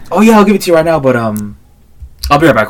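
high, loud put-on agreeableness; cheerfully string the caller along; mock compliance. Imitates man stalling a scammer.

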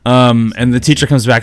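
ordinary talk, nothing marked

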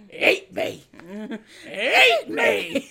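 high, loud taunting with a laugh; sing-song ate me, laughing through it; mock taunt. Kay taunting the bug, laughing mock taunt.

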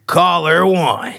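slow announcer call; fighting game announcer. Pretend. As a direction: call it like round one. Announcer intro to callers.

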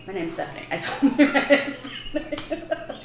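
usual speaking voice

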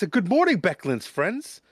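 high, cheery morning greeting; cheerful voice. Acted. Young man greets guests at their door, high and cheerful. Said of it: "comes a cheerful voice".

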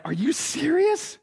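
high incredulous question; can't believe he's asleep; disbelieving. Acted. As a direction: Peter can't believe Jesus sleeps. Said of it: "that's like".

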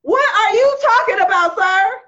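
high, loud shrieking outrage; outraged shrieking. Retold or imitated. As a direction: squeal it in comic disbelief. Outraged retort to father.

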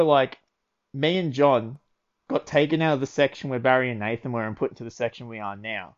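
ordinary talk

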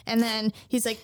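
ordinary talk, nothing marked

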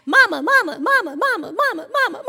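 loud, high, rapid-fire repeated pestering; nagging child. Retold or imitated. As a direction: pester like a kid who won't stop. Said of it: "when she just wants to nag us".

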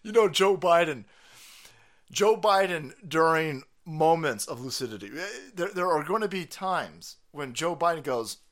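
ordinary delivery

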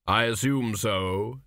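slow, distant reaper's voice; grim reaper voice. A narrator's reading. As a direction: deliver it slow and hollow, like Death. Death answers the king.

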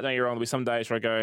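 usual speaking voice

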